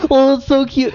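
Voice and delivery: high-pitched cooing imitation; mock a gushing fan in a high voice; exaggerated, squealy adoration. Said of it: "That's all anyone wants to fucking talk about".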